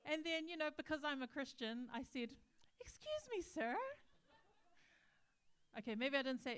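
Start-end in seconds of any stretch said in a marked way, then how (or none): 2.8-3.8 high-pitched indignant challenge; retold outraged politeness, pitched right up; mock affronted. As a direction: act out a scandalised objection. Retold affronted confrontation with barber.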